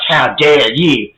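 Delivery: slow, drawn-out mock indignation; all offended. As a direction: stretch each word like someone putting on offence. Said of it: "Wendy gets all offended".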